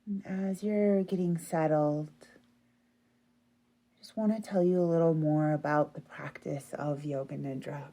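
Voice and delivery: usual speaking voice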